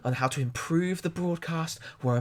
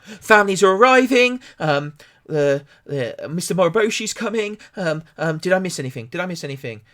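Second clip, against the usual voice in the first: frantic producer; 5 semitones higher, 9 dB louder than usual. Acted. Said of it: "freaking the hell out".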